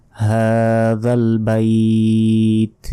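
হাযাল বাইত very slowly, "baiiit" held; stretched ending. Shown as a demonstration. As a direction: recite হাযাল বাইত with long vowels. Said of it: "শেষে টান".

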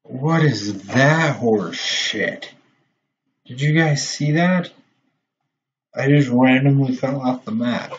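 loud, high, stretched-out what is that; outraged disbelief. Real, not acted. Gamer telling viewers he fell off the map, outraged.

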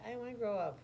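put-on high whiny baby voice; a baby refusing to grow up. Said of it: "They said".

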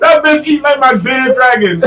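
high voice bursting with laughter; real laughter. Real, not acted. Friend joking about the date's eating, cracking up. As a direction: barely hold the laugh through the punchline.

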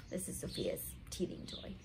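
whispered aside. Real, not acted. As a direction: say it under your breath. Aside about the toy.

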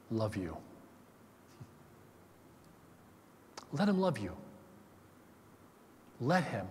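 low, soft, slow pleading; tender appeal. Real, not acted. Pastor inviting listeners to let God love them, soft and low. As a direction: draw them in gently, almost confiding.

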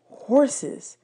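ordinary delivery, nothing marked

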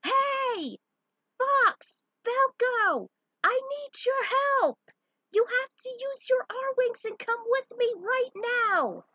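high, loud shout over roaring engines. A narrator's reading. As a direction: yell across to them above the engine noise. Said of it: "shouted to them".

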